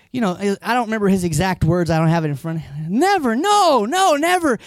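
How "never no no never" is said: Peter refusing. Retold or imitated. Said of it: "he's like".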